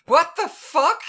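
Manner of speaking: high startled yell; shocked shout. Acted. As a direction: blurt it out in shock. Discovers hooves instead of fingers.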